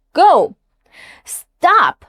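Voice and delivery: "Go." and "Stop," high and loud, dropping fast; sharp falling commands on "Go." and "Stop,". Shown as a demonstration. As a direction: drop "Go." and "Stop," short and sharp, as orders. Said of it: "drops fast, like giving a command".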